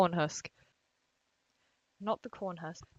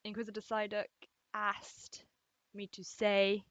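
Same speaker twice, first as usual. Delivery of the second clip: ordinary talk, nothing marked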